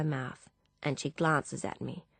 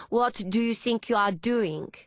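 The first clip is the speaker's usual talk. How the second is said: put-on higher, indignant French-accented voice; heavy French accent; 5 semitones higher, 3 dB louder than usual. A narrator's reading. Philippe confronting Hannah, heavy French accent. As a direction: challenge her in a thick French accent. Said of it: "in a heavy French accent".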